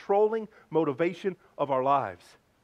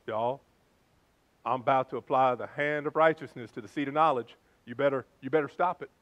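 lower-pitched, thick Southern drawl; 5 semitones lower than usual. Retold or imitated. Alabama friend to unruly kids, imitated thick Southern drawl. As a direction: imitate a Southern dad warning his kids. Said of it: "with his thick Southern accent".

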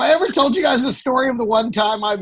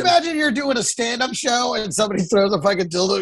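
ordinary talk, nothing marked

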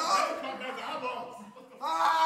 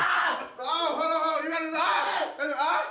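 shouting in spicy pain; frantic yells, mouth on fire; mouth burning from spicy food; 2 semitones higher, 4 dB louder than usual. Real, not acted.

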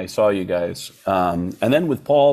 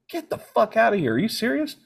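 stunned disbelief; 10 semitones higher than usual. Retold or imitated. Disbelieving reaction retold.

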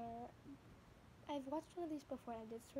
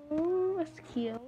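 slow, louder, higher aww; drawn-out aww; 4 semitones higher, 14 dB louder, much slower than usual. Real, not acted. Reacting to the clip on TV, slow aww. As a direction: gush over the sweet clip.

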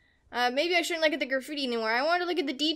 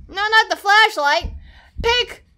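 frustrated shout; 7 semitones higher, 10 dB louder than usual. Real, not acted. Shouting at the controls.

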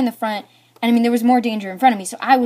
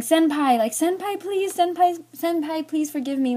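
high, cutesy senpai plea; mock pleading; 7 semitones higher than usual. Girl begging the boy to forgive her, mock pleading. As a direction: beg in a raised, singsong little voice.

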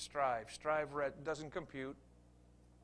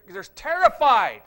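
dramatic emphasis; 2 semitones higher, 16 dB louder, slower than usual. Acted. Telling of disciples in storm.

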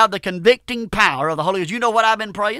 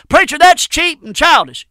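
high, loud sniffy objection; mock critic; 6 semitones higher, 7 dB louder than usual. Preacher voices a critic scoffing at his simple reading, high and loud.

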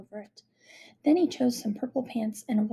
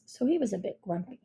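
ordinary delivery

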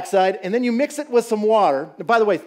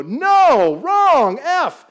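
high, loud teacher rejecting the answer; a teacher snapping at a wrong sum, high and loud; stern teacher; 8 semitones higher, 8 dB louder, much slower than usual. Retold or imitated. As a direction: bark it out like a strict schoolteacher. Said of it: "the teacher would go".

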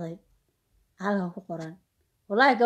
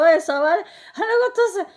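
higher, louder imitation of an agitated daughter; pleading daughter quote; 9 semitones higher, 5 dB louder, faster than usual. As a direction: act out her loud protest to mother. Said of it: "Waxay leedahay".

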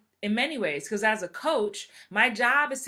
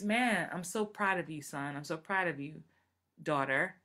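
put-on soft, lower praise to a child; tender pride, gently; proud parent; 4 semitones lower, 5 dB quieter than usual. Parent praising son and daughter, softly. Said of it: "For your kids".

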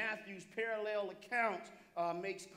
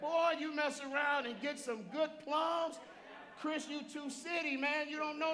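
higher-pitched, rousing Southern voice; 5 semitones higher than usual. Shown as a demonstration. Preacher bragging on good plums, exuberant Southern voice. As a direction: play up the down-home Southern talk. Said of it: "that's my southern voice".

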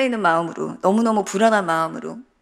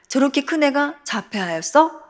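higher, rising, stress on 큰; viewer shock; 6 semitones higher than usual. Retold or imitated. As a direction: ask it in disbelief. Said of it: "두 번 놀라시고".

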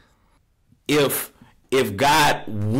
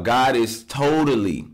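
normal talking voice

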